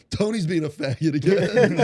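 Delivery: cracking up while quoting; laughing. Real, not acted. Retelling Shane's line to the table, laughing.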